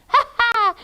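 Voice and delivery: high, loud gloating cackle; witch's cackle. Acted. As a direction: cackle over the captive. Witch gloats over trapped Hansel.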